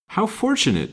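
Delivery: high-pitched, good-humored exclamation. A narrator's reading. Woman cheered by prospect of help, good-humored. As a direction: chime in, pitched well up. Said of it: "recovering her good humor".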